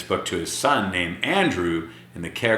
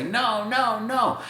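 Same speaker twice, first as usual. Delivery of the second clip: put-on kid refusing; 13 semitones higher, slower than usual. Kids refusing, voiced high.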